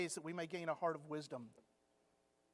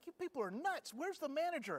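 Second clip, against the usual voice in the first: higher, indignant mock outrage at the store staff; exasperated outburst; 8 semitones higher than usual. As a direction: play the baffled customer losing patience, pitched up. Outraged man in store story.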